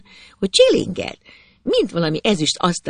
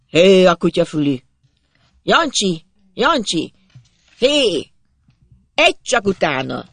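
raised, urgent hollering; father calling out; 3 semitones higher, 4 dB louder than usual. A narrator's reading. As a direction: call the lad sharply to give chase. Father calls his lad to chase.

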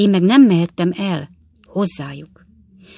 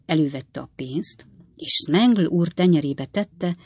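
ordinary delivery

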